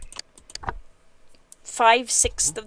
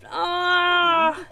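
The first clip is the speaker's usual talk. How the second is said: long, high, held "ah"; drawn-out mock cry; 6 semitones higher than usual. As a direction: sing out a sustained mock wail. Mock cry in the bathtub tale.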